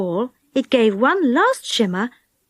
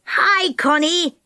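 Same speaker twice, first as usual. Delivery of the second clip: drawn-out, higher hail from below; calling out; 5 semitones higher, slower than usual. A narrator's reading. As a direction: hail someone further up. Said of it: "he called".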